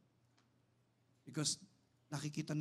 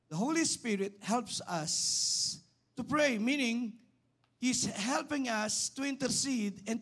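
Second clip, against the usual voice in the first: raised, slow, punchy teaching; emphatic preaching; 8 semitones higher, 6 dB louder, slower than usual. Real, not acted. Preacher explaining the Greek word to the congregation, emphatic and raised. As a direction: lift the voice and drive each phrase home slowly.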